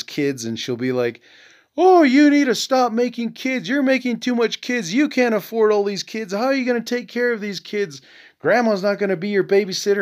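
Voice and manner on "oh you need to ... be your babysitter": high-pitched mock mom, scolding a granddaughter; imitating blunt mom. Son mimics mom scolding granddaughter about her weight, high mocking nag.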